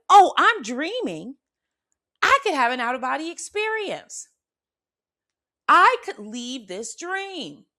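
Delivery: light up with the idea; thrilled discovery; excited realization. Retold or imitated. Sudden realization in the dream.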